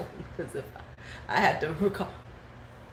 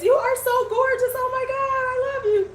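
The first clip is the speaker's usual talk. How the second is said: gushing fan; 3 dB louder than usual. Retold or imitated. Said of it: "Huda was all in his face".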